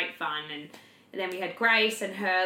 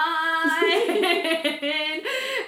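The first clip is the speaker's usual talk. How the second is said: sing-song laugh; 10 semitones higher, 6 dB louder than usual. Real, not acted. Sung laugh.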